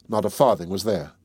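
ordinary delivery, nothing marked

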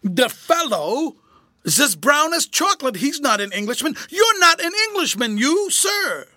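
a terrified quaver building to a cry; quivering voice. A narrator's reading. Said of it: "in the same quivering voice".

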